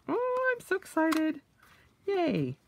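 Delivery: high giddy squeal; excited squeal. Real, not acted. Picturing it as her wallet, a high giddy squeal. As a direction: float it up high, thrilled.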